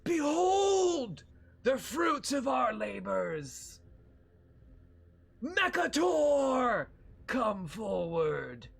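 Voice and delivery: high, slow, loud commanding villain; booming villain shout. Acted. Villain Triclops summons Mekator before the heroes, shouting high and slow.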